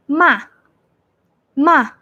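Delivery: "ma" starting high and dropping sharply; fourth tone, falling. Shown as a demonstration. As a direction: throw "ma" straight down. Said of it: "going down".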